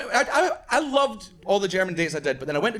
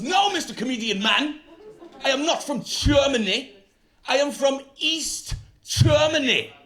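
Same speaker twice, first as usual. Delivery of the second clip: stern German man; 6 semitones higher, slower than usual. Retold or imitated. German man voiced in story.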